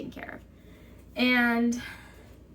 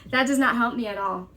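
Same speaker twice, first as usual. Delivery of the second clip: louder and faster, stress on "not"; emphatic repeat; 4 dB louder, faster than usual. Retold or imitated. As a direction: hit "not" hard, fed up.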